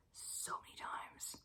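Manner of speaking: breathless slow whisper; whispered awe. Real, not acted. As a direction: hush it like a secret. Gushing over goosebumps.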